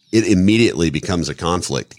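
ordinary delivery

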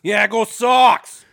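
high, loud, drawn-out sports cheer; mock fan cheer. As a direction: holler it like a mock Boston fan. Mock cheer for a Boston team.

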